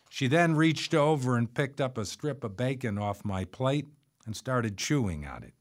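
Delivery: plain reading voice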